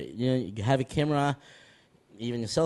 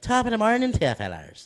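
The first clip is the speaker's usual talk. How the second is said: higher-pitched Irish greeting; Irish accent; 9 semitones higher than usual. Shown as a demonstration. Guest showing off his best accents to the host, Irish accent. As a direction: put on a stage Irish accent. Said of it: "an Irish accent".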